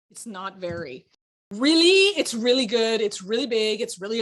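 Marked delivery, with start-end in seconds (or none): 1.5-2.1 mock emphatic. Shown as a demonstration. Mocking overused word.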